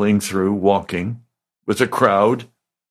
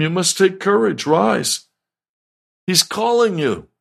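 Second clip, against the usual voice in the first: higher-pitched urging, lightly acted; calling to him; 10 semitones higher than usual. Crowd to the blind man Jesus wants, calling to him. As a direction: urge him up onto his feet. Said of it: "So they called the blind man".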